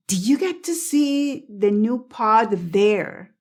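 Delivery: slow demo, D of "pod" heard before "there"; "pod there" with both consonants pronounced; audible D. Shown as a demonstration. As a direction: sound the D in "pod", then go straight on to "there". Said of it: "dicen la D y se escucha".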